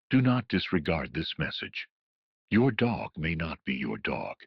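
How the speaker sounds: ordinary delivery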